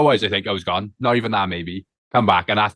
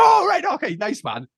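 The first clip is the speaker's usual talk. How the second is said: high-pitched 'oh', then dropping off; put-on high reaction to an introduction; mock impressed; slower than usual. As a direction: imitate someone suddenly impressed, then settle. Acted reaction to being introduced.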